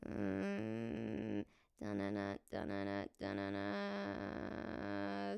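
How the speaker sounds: ultra low rumbling hum; subharmonic voice. Shown as a demonstration. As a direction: drop into a growling undertone. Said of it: "Subharmonic voice".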